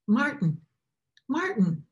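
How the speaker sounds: a voice calling out Martin twice; calling a name. A narrator's reading. Unseen voice calling the cobbler by name. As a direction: call his name across the dark room. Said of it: "he heard a voice call his name".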